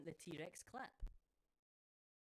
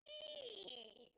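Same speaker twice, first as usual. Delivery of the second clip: high piercing mock eagle screech; a silly high bird cry; eagle scream imitation. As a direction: shriek it like a TV eagle. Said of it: "where they're like".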